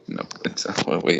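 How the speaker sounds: ordinary talk, nothing marked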